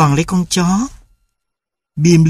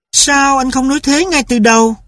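higher, mollified wife; a wife finally won over; mother relenting; 11 semitones higher than usual. A narrator's reading. Mother asks father why he didn't say so, higher.

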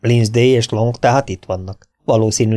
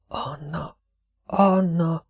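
terrified moan; 8 semitones higher, 6 dB quieter, much slower than usual. A narrator's reading. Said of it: "nyögte rémülten".

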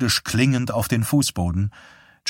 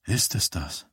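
quiet; 7 dB quieter than usual. Acted. Johnny quietly asks.

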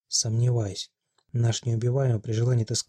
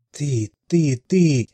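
higher, much louder jabbing cries; crazed accusation; 4 semitones higher, 7 dB louder, much slower than usual. A narrator's reading. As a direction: stab each word at her.

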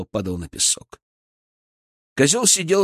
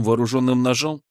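ordinary delivery, nothing marked

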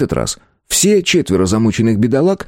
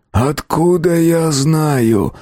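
slow, higher-pitched rasp; hoarse croak; 9 semitones higher, much slower than usual. A narrator's reading. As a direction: croak it out with effort. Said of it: "прохрипел до этого молчавший Кровосток". (хрипло, медленно)

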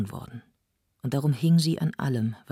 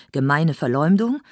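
louder indignant outcry; outraged cry; 3 semitones higher, 7 dB louder than usual. Acted. Fräulein Supper cries out at the accusation, outraged. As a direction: cry out at the slander. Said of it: "Fräulein Supper schrie".

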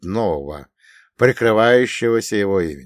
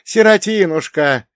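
wailing cry from the crowd; crowd lament; 8 semitones higher, 6 dB louder than usual. Acted. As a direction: lament it loudly, like a grieving crowd. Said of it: "неслось из толпы". (причитание из толпы)

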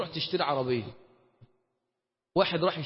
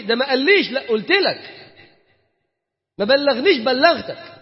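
louder, higher back-and-forth, slow firm reply; imagined exchange; 5 semitones higher, 7 dB louder than usual. Acted. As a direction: argue both sides at judgment day. حوار متخيل يوم القيامة. (جدال متخيل بصوت عالٍ)

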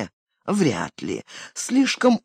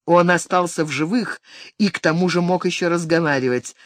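plain reading voice

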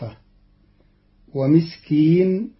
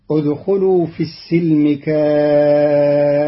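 "ادخلوا في السلم كافة" chanted louder, tartil; louder recited verse; 5 dB louder than usual. Shown as a demonstration. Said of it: "قرأ قالون كلمة السلم بفتح السين". (تلاوة مرتلة بصوت أعلى)

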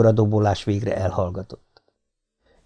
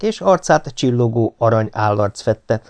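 ordinary delivery, nothing marked

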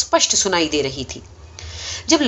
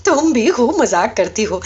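laughing; 2 semitones higher than usual. A narrator's reading. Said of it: "زور کا کہکہا لگایا".

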